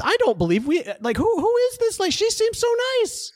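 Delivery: high-pitched feigned surprise, climbing as it goes; mock bewilderment. Host pretends not to know a returning character, mock bewilderment. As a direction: play innocent, voice rising high.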